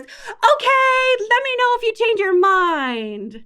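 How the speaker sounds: high, sing-song, drawn-out cheer; breezy cooing grandparent, trailing down slowly; agreeable grandparent. Acted. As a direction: give in sweetly to a little one. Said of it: "What they ought to say".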